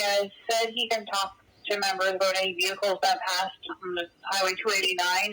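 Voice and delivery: ordinary talk, nothing marked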